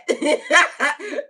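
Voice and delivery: high, loud cackle; real laughing burst. Real, not acted. Host waving off crazy talk, laughing loudly.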